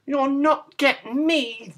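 high, slow, defiant pig voice; crying out as piggy. A narrator's reading. Piggy hiding from the wolf, high defiant cry. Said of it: "the piggy cried".

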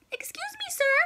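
very high, soft little voice; tiny polite voice. Acted. Small bird politely addressing the penguin, very high and soft. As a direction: squeak it politely. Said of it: "He says".

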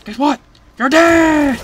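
high, loud gloating shout; gleeful taunt. Real, not acted. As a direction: crow it at a beaten enemy. Taunts enemy while shooting.

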